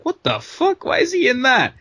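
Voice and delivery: high-pitched baffled outburst; mock disbelief. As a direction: squeak it like a stunned viewer. Voicing a baffled viewer's reaction.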